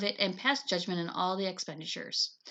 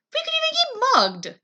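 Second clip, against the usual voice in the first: much higher, louder alarm; alarmed warning; 8 dB louder than usual. Acted. Sister warns brother of danger, high and loud. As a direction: paint the danger vividly.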